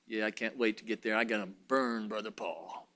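a hint of a scheming preacher; gloating wrong spirit. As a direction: voice the eager, spiteful preacher under his breath. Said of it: "The effect it has on my emotions".

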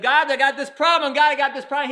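loud, high desperate plea; crying out to God. Retold or imitated. A troubled man crying loud to God. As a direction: call out to God in distress.

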